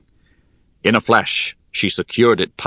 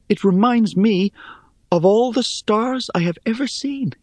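much higher, wondering voice; Rhea's voice; 13 semitones higher than usual. Acted. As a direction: lift it high and full of wonder. Rhea voiced by narrator.

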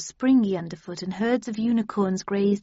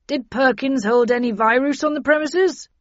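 loud, raised, pressing question; urgent questioning; 5 semitones higher, 8 dB louder than usual. Acted. Bradshaw asking about virus on the premises, urgent and loud. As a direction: demand an answer in a higher voice.

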